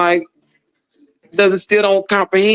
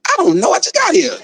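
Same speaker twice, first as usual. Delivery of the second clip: much higher-pitched, helpless mock protest; flustered protest; 13 semitones higher than usual. New inmate answering knife dealer, flustered protest. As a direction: plead cluelessness in a high voice. Clueless newcomer asked which knife.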